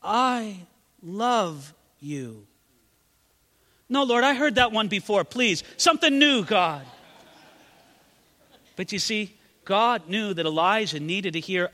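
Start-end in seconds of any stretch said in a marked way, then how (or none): 0.0-2.5 slow tender I love you; gentle voice of God. Acted. God's quiet voice. 3.9-6.8 higher, whiny brush-off; mock petulant refusal.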